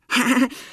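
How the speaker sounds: loud, awkward fake laugh; forced laugh. A narrator's reading. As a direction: laugh that does not sound real. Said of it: "ein Lachen, das nicht recht danach klingt". (gezwungenes Lachen)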